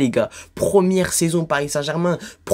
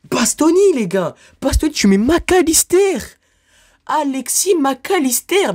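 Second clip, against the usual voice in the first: outraged exclamation; 10 semitones higher than usual. Real, not acted. Vlogger fuming at the nominations, outraged shout. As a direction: blurt it out in disbelief.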